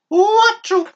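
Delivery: very high, loud cartoon plumber; Mario voice. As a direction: squeal like Mario.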